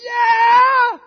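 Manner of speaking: very high, very loud yell of joy; congregation-rousing shout of joy; reckless cheer. Shown as a demonstration. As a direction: whoop with total abandon. Showing reckless rejoicing.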